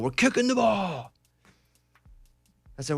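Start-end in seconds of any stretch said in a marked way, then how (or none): 0.0-1.1 coach's order. Retold or imitated. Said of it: "Coach Gandalf going".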